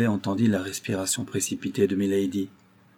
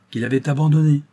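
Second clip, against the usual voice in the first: quick and sharp, pitched up and louder; brisk, animated cut-in; lively; 5 semitones higher, 6 dB louder than usual. A narrator's reading. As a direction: snap the interruption in eagerly. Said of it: "dit milady avec vivacité".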